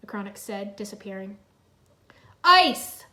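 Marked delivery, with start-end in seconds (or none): 2.4-3.1 high, loud battle cry; shout. A narrator's reading. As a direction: shout the spell. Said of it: "Zane shouted".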